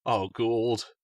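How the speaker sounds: plain reading voice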